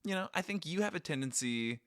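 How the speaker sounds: ordinary talk, nothing marked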